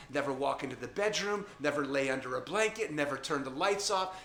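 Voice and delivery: ordinary talk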